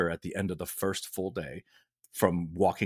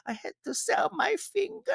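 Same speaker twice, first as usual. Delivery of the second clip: very high squeaky comic voice; weird fringy comedy bit. Retold or imitated. Recalling a running bit on set, weird squeaky voice. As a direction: pipe it up absurdly high. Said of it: "doing that bit a lot".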